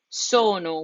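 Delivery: SONO with one short N after a long O; single N. Shown as a demonstration. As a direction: say the N in SONO only once, "so-no". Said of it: "We say the N once".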